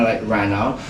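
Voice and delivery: ordinary delivery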